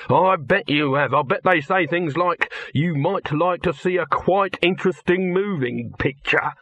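excited. A narrator's reading. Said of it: "said Dibbler excitedly".

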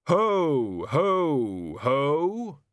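slow, deliberate ho-ho-ho; Death playing Hogfather. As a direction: boom out three separate, stilted hos.

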